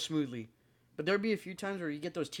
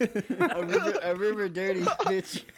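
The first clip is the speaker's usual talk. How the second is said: mocking voice; 3 semitones higher, 6 dB louder, slower than usual. Real, not acted. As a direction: parody the misheard words loudly. Mocking a misheard goodbye.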